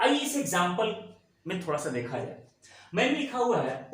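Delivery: ordinary talk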